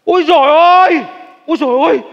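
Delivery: loud, high amazed cry; overjoyed exclamation at the money. Acted. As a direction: gasp at the pile of money. Son sees the money.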